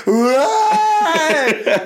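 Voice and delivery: long, high mock cheer; imitated dinner-hall cheer; crowd's jeering shout. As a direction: hold one high note like a whole canteen jeering. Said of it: "everyone in the room just will shout".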